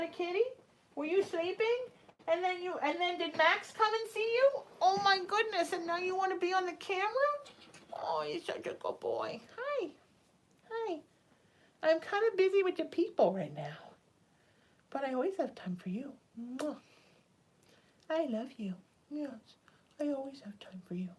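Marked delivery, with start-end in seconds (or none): 1.0-7.1 talking to a cat. Real, not acted. Her cat walks into frame. 7.9-21.1 talking to a cat. Real, not acted. Cuddling her cat on camera.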